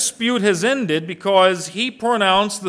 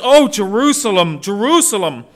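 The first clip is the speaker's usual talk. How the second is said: OH, JERUSALEM stressed, higher and louder, then the name repeated; emphatic lament; 4 semitones higher, 6 dB louder than usual. Retold or imitated. Preacher quotes God's heart for Israel, emphatic. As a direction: press hard on JERUSALEM both times, letting each fall. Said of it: "This is an emphatic statement".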